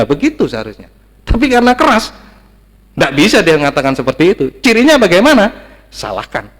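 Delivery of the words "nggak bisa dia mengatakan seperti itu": ordinary talk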